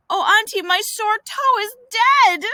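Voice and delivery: high, hammed-up wail; wailing complaint. Boy showing his aunt a sore toe, wailing. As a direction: wail it like a tragedy.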